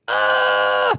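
loud low growl of frustration; big, low frustrated roar. Retold or imitated. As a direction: let out a long exasperated "ah". Frustrated dad's outburst, acted.

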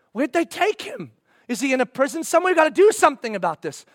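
high, loud, rushed questions; frantic urgency. Acted. Believer to neighbor about the captive, high and frantic. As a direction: demand answers and race ahead.

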